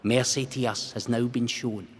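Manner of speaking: put-on slow, measured recitation of an old Scots verse; steady, rhythmic verse reading with Scots vowels; poem recital. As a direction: read it like a solemn ballad of defiance. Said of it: "he wrote a verse".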